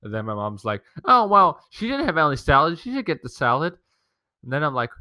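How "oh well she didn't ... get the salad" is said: higher, lightly voiced siding mother; a hint of mom. Mom telling him the sister should get the salad, mildly high mom voice. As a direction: take her side in a lightly put-on mom voice.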